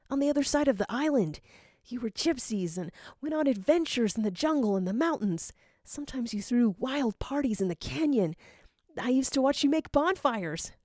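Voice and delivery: put-on high, rushing starstruck kid; eager young fan. Young Gadget gushing to his idol Zero, high and eager. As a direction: gush about your hero.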